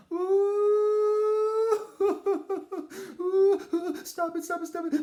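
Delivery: high, loud shrieking laughter; laughing mother. Retold or imitated. Mother laughing at her son, high loud laughing shriek. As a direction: squeal with laughter, begging stop. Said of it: "my mother's laughed".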